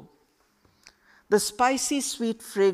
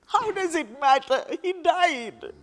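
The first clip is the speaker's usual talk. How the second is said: higher and louder, raw grief; anguished outburst; 10 semitones higher, 5 dB louder than usual. Acted. Girl snapping at Khalid over her father's death, anguished and loud. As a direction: throw it back at him, hurting. Grieving girl snaps back.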